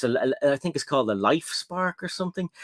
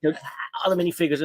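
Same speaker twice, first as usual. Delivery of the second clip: usual speaking voice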